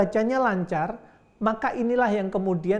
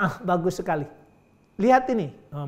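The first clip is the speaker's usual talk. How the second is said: ordinary talk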